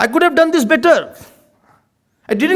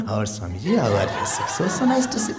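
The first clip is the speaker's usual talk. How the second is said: put-on much lower, soft sugary child greeting; sweet-talking child; 14 semitones lower, 8 dB quieter than usual. Child greets the Swamiji, sugary and soft. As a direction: put on a sugary polite greeting, laughing through it. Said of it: "The whole vibration pitch changes".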